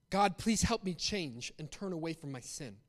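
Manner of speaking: ordinary delivery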